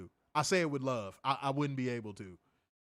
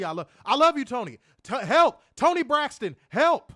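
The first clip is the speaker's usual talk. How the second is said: playful loud pleading call; mock serenade; 8 semitones higher, 10 dB louder than usual. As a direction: belt it like a desperate fan. Singing out to [name].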